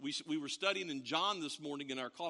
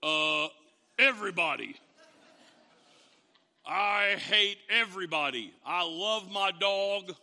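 loud, laughing grumble; grumpy dog lover; 6 dB louder than usual. Retold or imitated. Congregant ranting about loving their dog, loud. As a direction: hate everyone but the dog. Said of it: "some of you are going".

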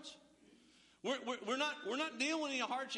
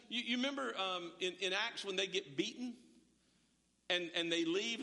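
ordinary delivery

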